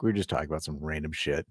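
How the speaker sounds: ordinary talk, nothing marked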